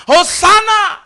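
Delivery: high, loud hosanna; crowd cry. Retold or imitated. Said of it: "Mereka teriak apa?". (seruan Hosana yang tinggi)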